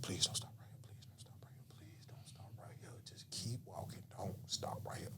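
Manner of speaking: hushed pleading whisper under the breath; desperate whisper. Retold or imitated. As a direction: beg her silently to keep walking. Pleading to woman not to stop.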